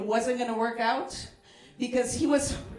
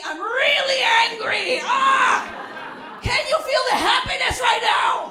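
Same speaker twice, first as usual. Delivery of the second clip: higher, louder, yelling joy that sounds like rage; angry happiness; 10 semitones higher, 3 dB louder than usual. Pretend. Comic showing how she expresses happiness, shouting angrily. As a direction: scream your happiness furiously. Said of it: "When I'm happy".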